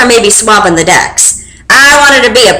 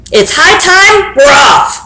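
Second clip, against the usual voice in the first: higher, slow call; captain announcing departure, slow and higher; pirate announcement; 7 semitones higher, slower than usual. Acted. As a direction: proclaim it to the ship. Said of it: "he announced".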